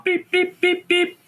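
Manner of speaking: high-pitched beep beep; bomb beeping. Retold or imitated. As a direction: chirp it like a timer.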